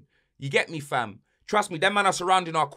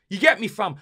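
ordinary talk

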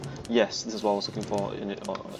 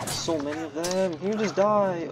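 high, drawn-out wail of despair; exasperated whine; 8 semitones higher, 6 dB louder, slower than usual. Real, not acted. Player swamped by enemies in a game, exasperated whine. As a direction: whine slowly, pitch climbing and getting louder, then sagging.

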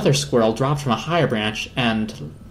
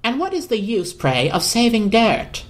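Harold the squirrel; 8 semitones higher than usual. Acted. Harold scoffs at the Hoover saving dirt, high and lofty. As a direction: ask it with lofty disdain.

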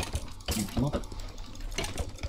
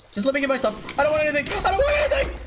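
high squealed pleading, rising higher; increasingly high-pitched panic; panicked pleading; 11 semitones higher, much faster than usual. Real, not acted. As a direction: beg frantically, climbing to a squeak. Pleading with attacking skeletons.

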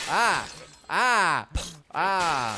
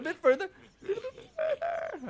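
very high squeaky plea; squeaky urging; 3 dB quieter than usual. Real, not acted. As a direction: coax it along. Urging the bird on.

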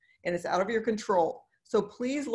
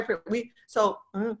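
normal talking voice

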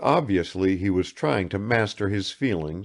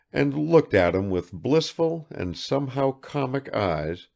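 ordinary talk, nothing marked

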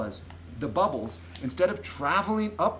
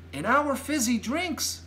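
put-on higher girl's voice, measured; light, high and deliberate; Sophie explaining; 11 semitones higher than usual. A narrator's reading. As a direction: explain slowly as a child. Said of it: "Sophie said".

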